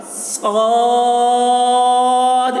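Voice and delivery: صاد sung out long and heavy; long held letter name. Shown as a demonstration. Teacher demonstrates صاد for students, chanted. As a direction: chant صاد thick and slow. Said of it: "اس طریقے سے".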